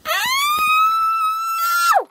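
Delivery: very high piercing scream of pain. Acted. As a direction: shriek as you're knocked off the rails. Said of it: "she screamed".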